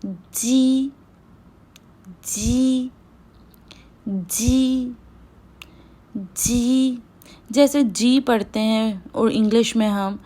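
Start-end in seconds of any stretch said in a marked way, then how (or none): 0.1-0.9 जी with teeth together, slow; pinyin j sound. Shown as a demonstration. Teacher modelling pinyin j, slow जी. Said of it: "जे को पढ़ते हैं जी". 2.3-7.0 जी said with teeth together; pinyin j sound. Shown as a demonstration. Teacher drilling the pinyin j sound. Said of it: "जे को पढ़ते हैं जी".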